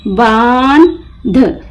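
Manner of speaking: बांध slowly split, long बान, pause, ध; broken into parts. Shown as a demonstration. Teacher breaking the word for learners, बान... ध with a gap. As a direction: detach the final ध of बांध. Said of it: "तीन हरूफ एक साथ पढ़ेंगे".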